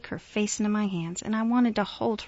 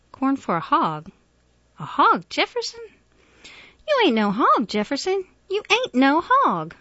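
indignant protest; 8 semitones higher, 7 dB louder than usual. A narrator's reading. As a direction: protest fiercely as an appalled older woman. Miss Emma indignantly answers Jefferson.

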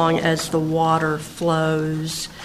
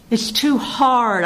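higher, drawn-out mild complaint; mild narrated cry; 7 semitones higher, 3 dB louder, slower than usual. A narrator's reading. Girl throwing down her fancy work, mildly crying out. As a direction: cry it out, kept within a poem reading. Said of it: "I cried".

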